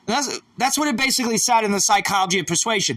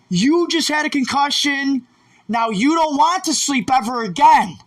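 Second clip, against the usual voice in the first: normal talking voice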